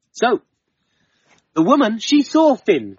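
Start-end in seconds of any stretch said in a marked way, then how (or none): none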